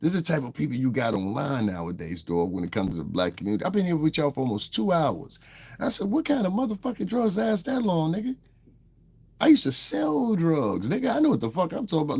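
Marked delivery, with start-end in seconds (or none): 3.6-12.2 high-pitched, disbelieving protest; incredulous. Real, not acted. Streamer answering chat's drug accusation, high-pitched and incredulous. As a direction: voice climbing in amused disbelief.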